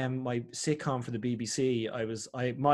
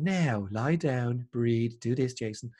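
very slow, calm guiding voice; meditation guide; 3 semitones lower, much slower than usual. Pretend. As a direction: lull him down step by step. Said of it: "guided meditation with somebody going".

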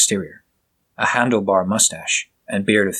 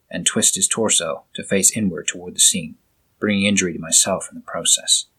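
ordinary delivery, nothing marked

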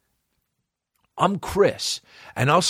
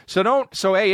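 usual speaking voice

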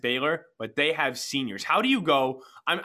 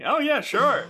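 mock clueless; 12 semitones higher, 5 dB louder, slower than usual. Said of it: "It's like".

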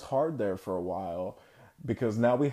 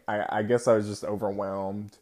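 normal talking voice

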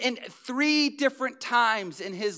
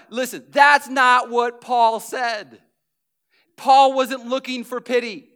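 louder, shouting the point home; fiery preaching; 3 semitones higher, 8 dB louder than usual. Real, not acted. Preacher rebukes the self-pity crowd, fiery shout. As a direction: preach it hard to the room.

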